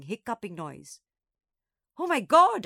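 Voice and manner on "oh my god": high, loud alarmed gasp; horrified outburst. A narrator's reading. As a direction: gasp it out in shock. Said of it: "I gasped".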